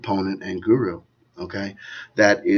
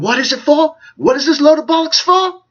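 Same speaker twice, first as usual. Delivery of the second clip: much higher, louder British accent, mocking a David Icke rant; hammed-up British conspiracy-guru accent, pitched way up; David Icke impersonation; 6 dB louder than usual. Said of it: "I can't do a David Icke impersonation".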